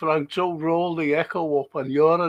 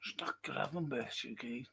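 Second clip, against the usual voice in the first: whispering; 4 semitones lower, 19 dB quieter than usual. Real, not acted. Muttering at the match.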